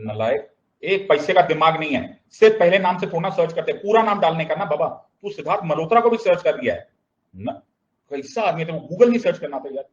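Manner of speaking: fast, high exasperated telling-off; mock scolding. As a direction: scold a silly friend in playful outrage. Acted scolding of the foolish friend.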